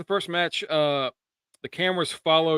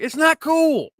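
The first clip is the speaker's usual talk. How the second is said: high, drawn-out angry retort; imitating someone losing patience; mock furious; 12 semitones higher, 4 dB louder, slower than usual. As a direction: act out an exasperated comeback. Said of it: "Priest guy was furious".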